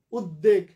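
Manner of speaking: উদ্বেগ said as ud-deg, no b; doubled d. Shown as a demonstration. Teacher voicing students' wrong rule, ud-deg. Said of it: "ব্যঞ্জনটা double হবে".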